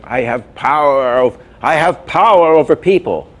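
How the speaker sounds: a grand, lightly voiced hypnotist boast; hypnotist villain. Retelling the cartoon hypnotist's line, mock grand boast. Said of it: "he says something like".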